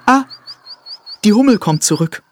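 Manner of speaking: laut und hoch rufend; Ausruf des Hasen. A narrator's reading. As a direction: aufgeregt die Hummel ankündigen. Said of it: "rief er". (der Hase ruft der Eidechse zu, laut und hoch)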